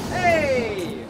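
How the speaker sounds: high, loud shout; calling out. Real, not acted. Trapped player calling out into the game, loud shout. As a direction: holler for attention.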